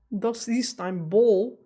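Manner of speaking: slow, mock American-accented German; imitated American accent in German; bad American-accented German. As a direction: draw out "Ball" like an American speaking German. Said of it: "without an American accent".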